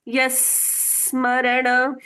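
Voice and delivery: slow chant, यस with the स held onto स्मरण; joined s sound. Shown as a demonstration. Teacher drilling the joined स, slow chant. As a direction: stretch यस into स्मरण, keeping the स linked. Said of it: "बाद वाला स यहाँ पर भी जोड़े रखना है".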